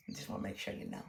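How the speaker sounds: breathy hushed confession; whispered aside. Real, not acted. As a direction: lean into the mic and whisper it. Quick aside about her real age.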